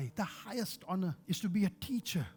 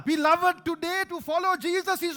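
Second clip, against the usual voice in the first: preaching shout; 9 semitones higher, 7 dB louder than usual. Real, not acted. Preacher to beloved church, loud high exhortation. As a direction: rouse the beloved.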